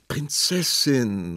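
slow, drawn-out disheartened call; dejected call. A narrator's reading. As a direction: call her name, losing heart. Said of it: "rief der Prinz entmutigt".

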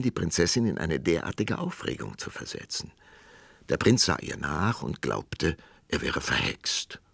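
ordinary delivery, nothing marked

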